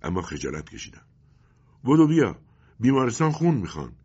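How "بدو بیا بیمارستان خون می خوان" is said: loud, high-pitched urgent call; urgent shout. Acted. Calling someone to the hospital to give blood, urgent shout. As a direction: summon someone to hurry.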